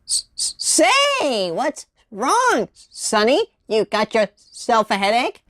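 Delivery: soft, slow stuttery gopher voice; a whistly cartoon gopher; gopher impression. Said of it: "gopher it is".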